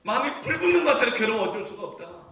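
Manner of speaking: high, loud cry of anguished love; impassioned preaching. Acted. Preacher voices God's burning love for his people, impassioned.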